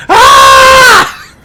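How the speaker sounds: high loud yelp; mock yelp of pain. Mother yelping as dad forces in her aid, high yelp. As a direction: yelp as the hearing aid goes in.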